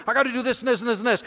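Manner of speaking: exasperated husband listing his duties at wife; rattled-off angry list. Retold or imitated. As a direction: spit the list out in a rush.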